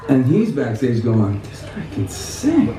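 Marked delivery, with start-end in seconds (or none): 1.4-2.7 imitating hushed, high-pitched amazement; slow, softly marvel as the impressed onlooker; retold amazement. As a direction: act out a soft, drawn-out astonished reaction. Impressed hero reacting backstage, retold.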